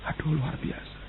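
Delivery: soft breathy aside of wonder; awed whisper. Real, not acted. Preacher marvels at God to the congregation, whispering. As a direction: breathe it out in awe.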